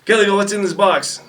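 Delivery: high, loud mock interrogation; drill sergeant voice. Other battery's drill sergeant questioning a recruit, high mock bark. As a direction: grill him like a drill sergeant.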